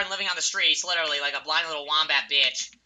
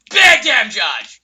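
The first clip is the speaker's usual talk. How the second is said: excited shout; 3 semitones higher, 11 dB louder, much slower than usual. Real, not acted. Hype after big play.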